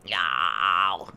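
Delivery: low, drawn-out mock meow; cat meow impression. Imitating his cat walking into the room, low and drawn out. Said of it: "He's like".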